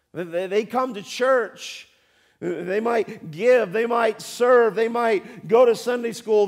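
loud, full-force sermon delivery; preaching. Acted. Pastor preaching hard to the congregation. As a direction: drive it home from the pulpit.

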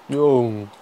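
usual speaking voice

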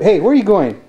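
high, playful chase call. Real, not acted. Chasing a running toddler.

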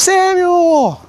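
high, far-off call of Samuel's name. Acted. God calls the sleeping boy Samuel, high drawn-out call.

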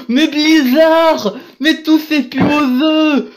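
high, slow, loud complaint; exasperated outburst. Real, not acted. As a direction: cry out at Blizzard in despair.

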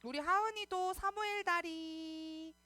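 slow, drawn-out phrase endings. Real, not acted. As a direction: coax little ones into prayer posture, stretching the words. Singsong cues to get ready for prayer.

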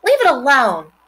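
loud, high sharp order; a girl sharply telling her brother to stop; commanding. A narrator's reading. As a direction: order him off it. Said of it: "commanded Fern".

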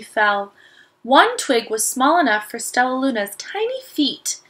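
put-on expressive read-aloud to children; story reading. Teacher reading Stellaluna to the class, lively storytelling. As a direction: bring the picture book alive. Reads picture book to children.

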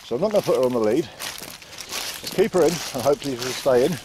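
ordinary talk, nothing marked

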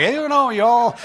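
high, loud mock Southern drawl on y'all; hammed-up Southern accent. Joking to the congregation about going Southern, hammed-up Southern accent. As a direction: play a Southerner after two beers. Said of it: "I'm yawling with them".

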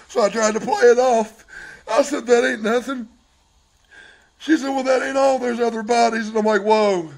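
high, loud, tearful outpouring; distraught. Real, not acted. Husband recounting wife's confession about bodies, distraught. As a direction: tell it loudly, still upset from crying.

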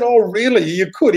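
high-pitched, playful retold surprise; intrigued surprise. Woman reenacts hearing his matchmaking offer, intrigued. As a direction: act pleasantly taken aback by an offer.

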